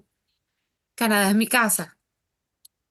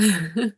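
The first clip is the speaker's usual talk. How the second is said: laugh. Real, not acted. Joyful laugh remembering Barranquilla.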